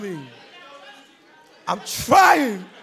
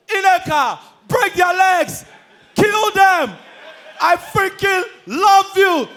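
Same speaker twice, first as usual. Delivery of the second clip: high, loud, slowed screaming at the hospital; screaming cheer; 11 semitones higher, 5 dB louder, slower than usual. Retold or imitated. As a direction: bellow it at full voice. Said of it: "screaming at the top of my voice".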